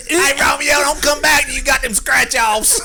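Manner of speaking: high, loud hollering country voice, laughing. Pretend. Co-host joins the Alabama Romeo and Juliet parody, hollering. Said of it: "Alabama Shakespeare Festival".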